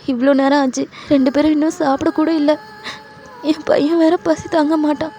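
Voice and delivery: high, whimpering acted lament; tearful, high-pitched fretting; crying with worry. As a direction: lament through tears, full of guilt. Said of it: "அப்படின்னு ரொம்ப feel பண்ணி அழுதுட்டு இருக்கீங்க".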